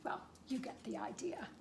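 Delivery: ordinary delivery, nothing marked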